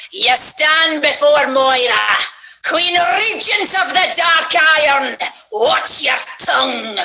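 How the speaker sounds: a tiny queen with a giant voice, Scottish and regal; Moira Thorson. Shown as a demonstration. Voice actor demos her dwarf queen in a Scottish accent. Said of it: "my Scottish character is, um, Moira Thorson".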